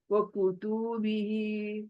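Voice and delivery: व कुतुबिही with व, कु, तु, बि quick and ही held long; long final hee. Shown as a demonstration. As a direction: rush व कुतुबिही then stretch the ही. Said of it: "ही खींचना है". (व कुतुबिही में केवल ही को खींचकर)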